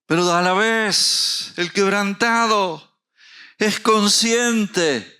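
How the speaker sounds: high, loud, slow preaching cry; fiery preacher voice, drawn out; preaching at full force. Acted. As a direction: proclaim it to the whole church. Preaching climax.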